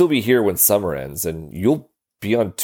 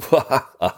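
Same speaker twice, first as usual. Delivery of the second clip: short laugh; 6 semitones higher than usual. Real, not acted. Laughing at coach being scolded.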